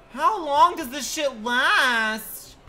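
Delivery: high, loud, drawn-out whine; slow loud complaint. Real, not acted. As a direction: drag out the annoyance. Blinded in game.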